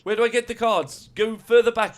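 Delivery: put-on high, loud South African accent. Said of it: "in our South African accents".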